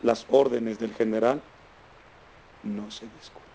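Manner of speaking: grave y bajando hasta casi perderse; sentencia solemne. Real, not acted. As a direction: repite la máxima en voz baja, con una pausa en medio. Repite solemnemente la moraleja del relato. (sentencia solemne, en tono bajo y pausado)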